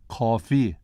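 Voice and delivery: "Coffee" in Malaysian tones, three then one; Malaysian accent. Shown as a demonstration. Lecturer explaining the special accent, Malaysian-accented "Coffee". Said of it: "is three one".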